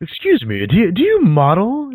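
lightly mocked pickup line, rising high on "model"; cheesy pickup artist. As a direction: try out a cheesy come-on, slowing at the end.

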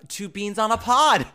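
loud, high delighted squeal; excited. Real, not acted. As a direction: gasp with sudden delight. Excited discovery of name.

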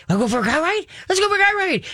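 building to a very high squeal; excited dog talk. Shown as a demonstration. Revving the dog up.

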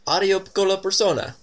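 high, hammed-up Italian guard. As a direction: call it out like a cheery Italian. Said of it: "he calls after you".

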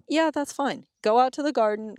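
lightly acted, high-pitched go-ahead; breezy permission. Mom letting hungry daughter raid garden, lightly acted breezy consent. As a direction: lift the pitch, readily agreeing.

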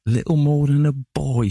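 high, loud, scornful mockery; sneering. A narrator's reading. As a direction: sneer the words back at him. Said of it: "she sneered".

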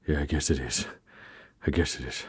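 glum murmur. Acted. Gloomy warrior replies.

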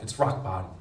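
ordinary talk

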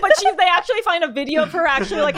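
high, loud, laughing tease; laughing teasing. Real, not acted. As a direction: giggle through the teasing.

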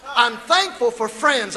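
ordinary talk, nothing marked

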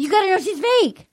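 high-pitched pretend outrage; imagined exasperated protest; mock exasperation. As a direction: plead it high, as fed-up housemates. Voicing housemates' imagined outburst.

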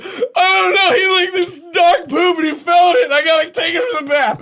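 high, loud panicked yelling; frantic shouting. Retold or imitated. Dad explains the mess to daughter, frantic shout.

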